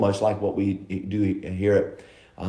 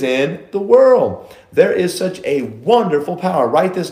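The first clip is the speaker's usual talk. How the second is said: preaching; 4 semitones higher than usual. Acted. Preacher building intensity.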